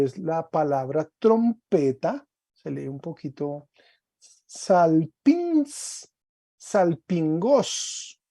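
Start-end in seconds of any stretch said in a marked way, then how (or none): 4.5-6.1 salpins, stressed high on the final syllable; Greek pronunciation. Shown as a demonstration. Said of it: "tiene un acento agudo en la A". 6.6-8.2 salpingos drawn out syllable by syllable; slow dictation. Shown as a demonstration. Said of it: "cuando se expande en el genitivo queda salpingos".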